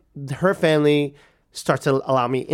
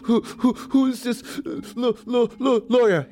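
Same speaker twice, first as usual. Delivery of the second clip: high, stammering through sobs; mock crying, breath catching on words; imitated sobbing; 9 semitones higher than usual. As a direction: stutter each word out between acted sobs. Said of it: "just crying".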